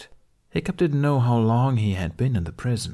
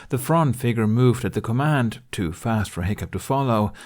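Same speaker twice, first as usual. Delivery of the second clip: usual speaking voice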